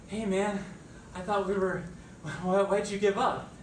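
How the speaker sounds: exasperated plea. Acted. Complaining to the dog.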